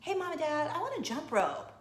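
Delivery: a hint of an eager little kid; eight-year-old child. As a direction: pipe up like a child asking parents. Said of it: "my eight-year-old came home".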